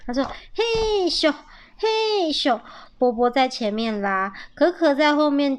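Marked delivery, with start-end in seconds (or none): 0.5-2.6 high-pitched acted effort chant; heave-ho. A narrator's reading. Little squirrels pulling and pushing a sled, heave-ho.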